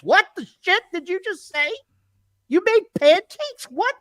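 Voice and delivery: high-pitched baffled outburst; mock incredulous. Podcaster answering his parody promo, high incredulous questioning. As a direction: react in disbelief, pitch leaping up. Reacting to absurd mimicked promo.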